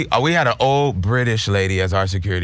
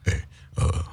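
sudden low, slow self-conscious radio voice; mock radio voice; 11 semitones lower, 3 dB quieter, slower than usual. Teasing a friend who turns stiff on mic, deep radio voice. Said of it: "you was like".

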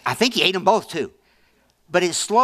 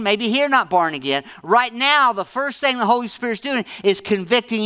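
loud, higher preaching, driving every line; full-force preaching; 5 semitones higher, 7 dB louder than usual. Acted. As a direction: preach it out to the whole congregation.

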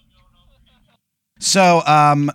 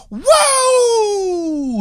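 long high wrestler "whoa"; wrestler hype yell. Retold or imitated. Wrestler howling into his mic, in a long high yell. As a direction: let out a huge hype howl.

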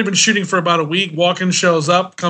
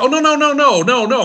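high, rushed, flustered no's; flustered denial; 7 semitones higher than usual. Retold or imitated. Assistant hastily reassuring the star, flustered high. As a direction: scramble to reassure him.

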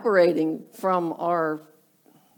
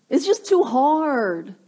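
slow, higher, drawn-out moan; mock complaining; 4 semitones higher, slower than usual. Unhappy worker giving up, mock complaining. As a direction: drag it out like a fed-up quitter.

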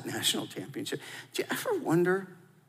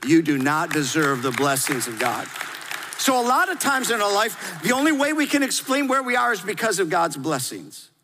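loud, high, full-force preaching; fired-up sermon delivery with a high, ringing voice; 11 semitones higher, 5 dB louder than usual. Acted. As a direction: preach it out to the whole room. Preaching to the congregation.

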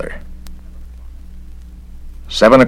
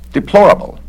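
high, louder prim disdain; disapproving; 7 dB louder than usual. Acted. Inspector judges the witch, high and prim. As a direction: sniff it like a censor.

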